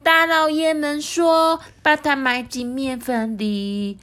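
drawn-out high singing line; slow high sing-song. Acted.

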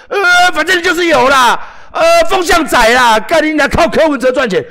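high, loud jeering insistence; insistent heckler. Retold or imitated. Heckler insisting he profits from Ko Wen-je, high loud jeer. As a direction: dig in like a stubborn troll.

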